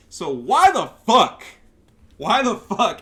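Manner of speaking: loud, slow outraged yell; angry shout. Real, not acted. Ranting at the devs over the nerf, slow furious shout. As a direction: hit every word in disbelief.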